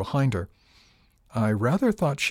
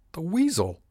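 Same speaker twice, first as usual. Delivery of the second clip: indignant outburst; 8 semitones higher than usual. A narrator's reading. Indignant outburst at Jarvis's scheming.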